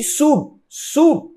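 "sub" punched high, as a strong syllable; stress on SUB. Shown as a demonstration. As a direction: isolate "sub" and push the pitch up on it. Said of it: "também é forte no início da palavra". ("sub" destacado, agudo e enfático)

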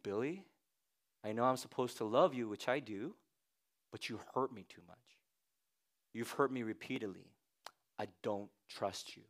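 soft, low, wounded; hurt quiet refusal. Retold or imitated. Wife Betty tells husband Billy she can't trust him, softly. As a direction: tell him gently you're done. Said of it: "Betty's over here saying".

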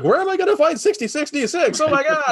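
high, flustered mock panic; panicked squeak. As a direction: squeal it in disbelief. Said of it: "And Morgan's like".

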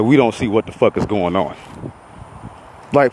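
ordinary talk, nothing marked

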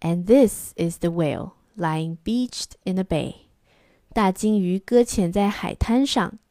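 plain reading voice

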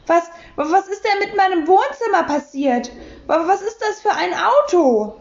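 high, stumbling outrage; aghast. A narrator's reading. Lürcher splutters at his wrecked living room. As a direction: sputter in shock at the ruined room.

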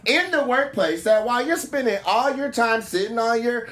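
put-on high, slow, drawn-out sister-girl clapback; sassy sister-girl voice. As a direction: play her as a hammed-up ratchet girl.

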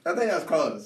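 loud laughing reply. Real, not acted. Laughing at squat demo.

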